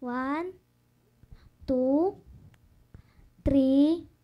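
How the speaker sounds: dance-teacher count building up. Shown as a demonstration. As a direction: count the beats, building from normal to loud. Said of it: "Tapos dito".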